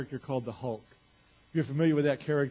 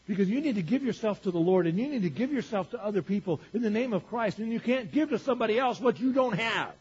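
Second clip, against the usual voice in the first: louder, higher preaching that builds; forceful preaching; 6 semitones higher, 5 dB louder than usual. Real, not acted. Preacher urging people to give themselves to others, forceful preaching. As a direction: drive the point home with rising force.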